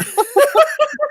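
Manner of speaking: loud, high laugh; high burst of laughter. Real, not acted. Surprised by the mix-up.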